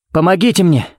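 high-pitched call for help; cry for help. A narrator's reading. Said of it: "крикнул он".

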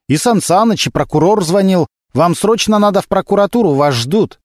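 high-pitched, pressing report; urgent summons. A narrator's reading. Sergeant urgently summons the captain. (взволнованный срочный доклад)

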